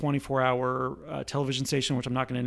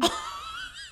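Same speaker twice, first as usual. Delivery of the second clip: high squeal laugh; 8 dB quieter than usual. Real, not acted. High laugh after singing.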